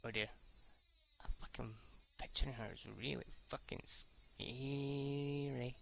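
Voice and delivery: usual speaking voice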